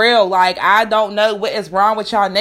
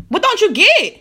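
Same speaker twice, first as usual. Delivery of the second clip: exasperated; 8 semitones higher than usual. Real, not acted. Exasperated rant question.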